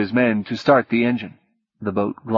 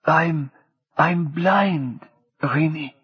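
halting and higher, each word pushed out; forced out with effort; 6 semitones higher, much slower than usual. Acted. Martine, weak and hurt, forces the words out to Rini. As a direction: struggle to get the words out. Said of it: "forced the words out with great effort".

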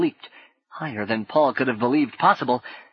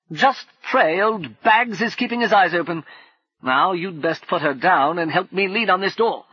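higher, louder gruff character voice; a bold soldier of fortune giving orders; brash adventurer; 7 semitones higher, 4 dB louder than usual. Acted. Brumman's character voice.

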